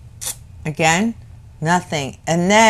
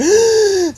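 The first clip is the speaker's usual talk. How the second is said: mock gasp; 11 semitones higher than usual. Imitating panting after running.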